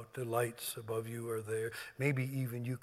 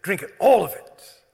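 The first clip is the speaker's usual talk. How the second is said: loud command; 6 semitones higher, 16 dB louder than usual. Acted. Voicing Jesus at supper.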